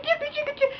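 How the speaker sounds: high, quick "chicka" stutter; little high-pitched repeated clicks; imitated flickering noise. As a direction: mimic a glitchy light switching. Imitates dome light noise.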